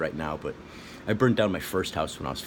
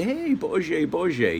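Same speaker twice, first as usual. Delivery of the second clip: calling out a name; 10 semitones higher, much slower than usual. Retold or imitated. Communists calling out to father.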